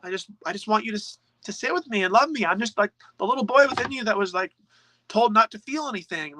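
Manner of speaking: put-on high, pleading child's voice of the inner boy; the shadow answers as a small neglected boy, high and plaintive; little boy voice. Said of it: "It's like".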